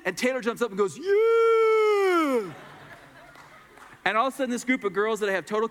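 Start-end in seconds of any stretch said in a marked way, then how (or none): 1.0-2.5 high, loud long cheer; joyful yell. Retold or imitated. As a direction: shout it with arms up. Taylor jumps up cheering.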